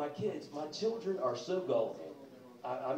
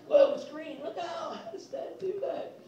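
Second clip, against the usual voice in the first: put-on high, loud astonished kid voice; mock awestruck children; amazed kids; 14 semitones higher, 4 dB louder than usual.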